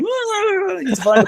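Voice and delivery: high panicky "ah, it's fine"; mock panic, squeaky and strained; panicked voice. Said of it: "running around the house going".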